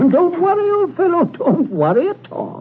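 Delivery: high, sing-out joviality; breezy reassurance. Acted. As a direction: brush his worries off cheerily. Host breezily reassures shaken victim.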